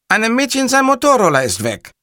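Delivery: high, loud character voice; hapless caller Cattarella. Acted. Cattarella reports the missing scooter, high and loud. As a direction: report it in a high-pitched, earnest squawk.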